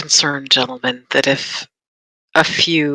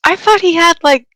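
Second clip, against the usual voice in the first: surprised; 5 dB louder than usual. Real, not acted. Incredulous reaction.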